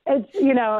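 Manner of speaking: ordinary talk, nothing marked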